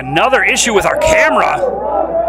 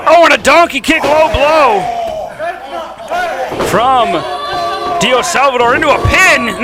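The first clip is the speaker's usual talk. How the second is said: booming pumped announcer; 4 semitones higher than usual. Real, not acted. Low blow call, commentator erupts.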